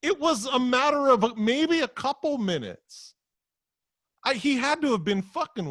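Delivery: high, loud, slowed heated correction; real exasperated rant. Real, not acted. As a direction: insist it was only minutes. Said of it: "out of breath from ranting".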